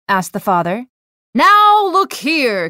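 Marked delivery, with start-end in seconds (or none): 1.3-2.7 slow, stern angry warning; a man fed up with the calls snaps back; furious reply. A narrator's reading. A furious man on the phone, slow and stern. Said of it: "came the furious reply".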